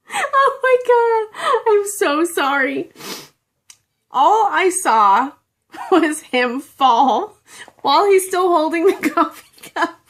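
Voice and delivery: high, tearful laughing through the words; laughing so hard it turns to crying; helpless laughter. Real, not acted. Telling viewers about a friend's fall, helplessly laughing. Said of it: "I cannot tell this story without dying".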